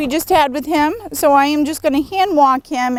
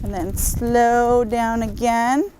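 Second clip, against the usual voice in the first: drawn-out slow; much slower than usual. Shown as a demonstration. Showing slowing down.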